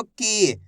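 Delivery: की with a long, falling ee; drawn-out vowel. Shown as a demonstration. Teacher drilling the long matra, की drawn out. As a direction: stretch the ee in की. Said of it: "खींच खींच के उच्चारण".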